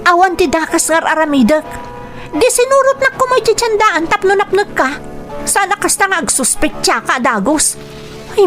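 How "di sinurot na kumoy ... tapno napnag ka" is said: higher-pitched indignant protest; acted lovers' quarrel, pitched up; indignant retort. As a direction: argue back in a raised pitch. Quarrel with jealous partner.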